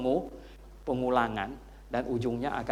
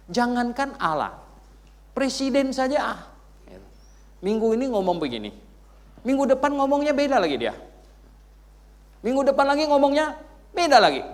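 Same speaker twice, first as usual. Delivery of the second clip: loud, high preacher building his point; preaching at full force; 12 semitones higher, 9 dB louder than usual. Acted. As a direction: hammer it home to the congregation. Berkhotbah dengan semangat penuh. (khotbah berapi-api, keras dan tinggi)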